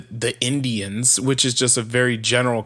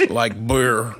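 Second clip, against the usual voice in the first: put-on low Upper Midwest North Dakota accent; 3 semitones lower than usual. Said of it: "You just did a perfect North Dakota accent".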